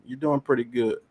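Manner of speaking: ordinary talk, nothing marked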